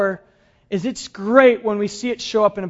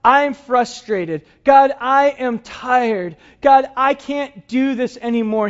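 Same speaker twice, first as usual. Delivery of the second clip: slow, louder, pleading frustration; weary Elijah; 5 semitones higher, 5 dB louder, slower than usual. Retold or imitated. Elijah pours out his exhaustion to God, slow, pleading. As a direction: lean in and drag out the tired plea. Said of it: "kind of like Elijah saying".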